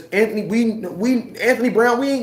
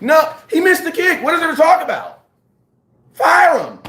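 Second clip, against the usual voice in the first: higher, louder, fed-up yelling; exasperated shout; 7 semitones higher, 4 dB louder than usual. Real, not acted. Ranting to podcast listeners about the kicker, exasperated shout. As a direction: blow up at the idea.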